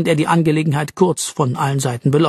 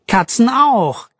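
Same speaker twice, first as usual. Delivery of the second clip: higher, stretched-out, pointed comeback; sharp retort; 7 semitones higher, 3 dB louder, slower than usual. A narrator's reading. Arabella hits back at Jean's superstition, sharply. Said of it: "gab Arabella scharf zurück".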